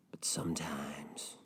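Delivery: very soft, slow, drifting off; daydreaming. Acted. Donor lost in another life, soft and dreamy. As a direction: trail away into a daydream. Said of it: "Just daydreaming about another life".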